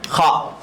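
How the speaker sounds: rasped خا from the upper throat; letter sound demo. Shown as a demonstration. Teacher sounding خا for the class. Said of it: "دو حرف اوپر سے نکلتے ہیں".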